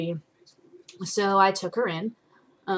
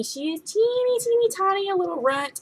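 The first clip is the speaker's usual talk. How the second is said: cutesy coo; 12 semitones higher than usual. Real, not acted. Cooing over tiny ferret.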